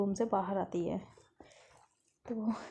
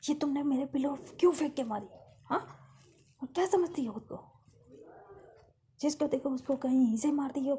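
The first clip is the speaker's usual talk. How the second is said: angry scolding; 6 semitones higher than usual. Acted. Caretaker scolding the girl who hit her with a pillow, angry. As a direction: tell her off hard. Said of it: "कहती है".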